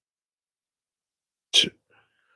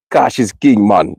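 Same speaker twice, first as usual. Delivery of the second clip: imitated Jamaican accent, pitched up. Quoting a guy he knew, in a Jamaican accent. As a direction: quote him in a put-on Jamaican accent. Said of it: "It's with an accent".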